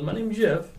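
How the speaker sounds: movie catchphrase impression. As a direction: quote the film line in a slightly silly high voice. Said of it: "I thought he goes".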